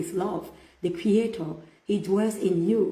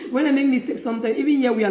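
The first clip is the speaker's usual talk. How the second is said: laughing; 5 semitones higher, 6 dB louder, faster than usual. Real, not acted. Laughing at her own mistakes.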